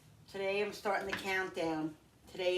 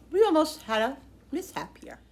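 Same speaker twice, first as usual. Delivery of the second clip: startled; 6 semitones higher, 9 dB louder than usual. Real, not acted. Near kitchen mishap.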